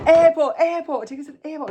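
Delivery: high, urgent repeated imitation yell; mock scream. Passengers plead with taxi driver, mock screaming. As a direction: yell it high, then drop the volume. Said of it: "physically screaming".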